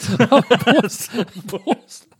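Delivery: high laughing "Prost"; laughing toast. Real, not acted.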